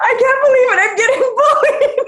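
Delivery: high, laughing mock outrage; laughing disbelief. Retelling being shoved, laughing high disbelief. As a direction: squeal it through giggles. Retelling her own reaction.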